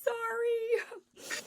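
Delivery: drawn-out, high, whining sorry; whiny apology. Real, not acted. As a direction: stretch it out like a groan. Frustrated by bad connection.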